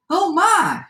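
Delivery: high cry from a rancher's wife; startled shout. A narrator's reading. Rancher's wife sees the gingerbread run off, high startled shout. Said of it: "shouted the little old rancher's wife".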